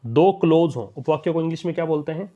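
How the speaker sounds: usual speaking voice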